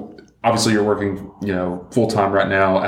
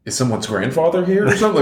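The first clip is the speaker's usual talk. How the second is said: higher, incredulous retold question; confused by the word pop, mock disbelief; mock bewilderment; 8 semitones higher than usual. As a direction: sound baffled, as if it's absurd. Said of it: "I was like".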